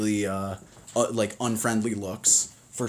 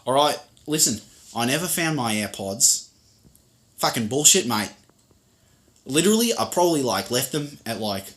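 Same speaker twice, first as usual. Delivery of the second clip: higher, punchy Aussie bro accent; Australian street accent; 4 semitones higher, 5 dB louder than usual. Shown as a demonstration. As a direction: gripe to a mate in broad Aussie. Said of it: "you got the stuff down here where it's like".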